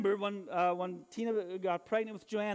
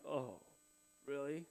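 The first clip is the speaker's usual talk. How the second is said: very low, soft, underwhelmed; quiet, reluctant retold response; deflated reaction; 5 semitones lower, 10 dB quieter, slower than usual. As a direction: let it drop, as if stunned and unenthused. Acts out his stunned, unenthusiastic reaction.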